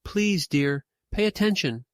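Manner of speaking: frightened plea, rising high; mother pleading with her son, alarmed; cried out in fear. A narrator's reading. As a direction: beg him to be careful, scared. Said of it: "She cried out in fear".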